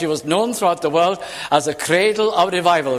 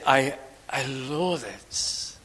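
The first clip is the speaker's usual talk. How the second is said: slow, soft, heavy "loathe" drawn out with disgust; stress on loathe; 5 semitones lower, 7 dB quieter, much slower than usual. Shown as a demonstration. Preacher showing his flock how strong loathing sounds, slow stress on "loathe". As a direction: lean into "loathe" slowly and quietly. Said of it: "that's very powerful".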